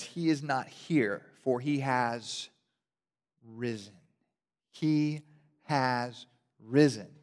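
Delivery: slow, weighty reading; slow solemn angel. Acted. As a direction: let each word land slowly. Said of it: "The angel says".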